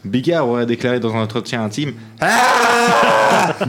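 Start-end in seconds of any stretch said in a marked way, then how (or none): 2.2-3.5 high sudden yelp; a quick high exclamation; mock cry. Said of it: "À peu près comme ça".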